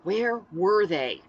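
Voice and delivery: slow, impatient hissed question. A narrator's reading. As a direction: hiss it out impatiently. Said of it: "I hissed impatiently".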